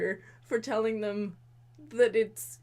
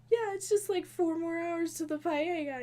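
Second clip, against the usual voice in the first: imitating Devin; 6 semitones higher than usual. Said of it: "he's like".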